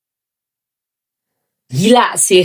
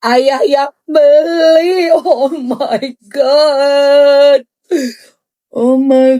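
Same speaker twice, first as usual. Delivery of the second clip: overcome with awe; 4 semitones higher, much slower than usual. Real, not acted. Overwhelmed by bag.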